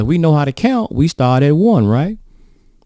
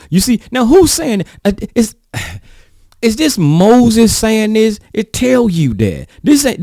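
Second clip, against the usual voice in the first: high, loud, rising pulpit fervor; preaching at full force; 7 semitones higher, 4 dB louder than usual. Acted. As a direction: drive it home to the congregation. Preacher hits his point.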